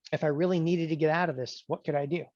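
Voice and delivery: usual speaking voice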